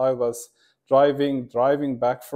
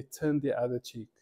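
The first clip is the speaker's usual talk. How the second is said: soft divine voice; 2 semitones lower, 9 dB quieter than usual. Retold or imitated. God's words quoted.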